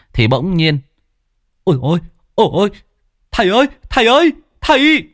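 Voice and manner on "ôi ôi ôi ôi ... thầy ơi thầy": put-on boy's alarmed cry. Boy shouts alarm to wake his teacher. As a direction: call out to the teacher in mock panic.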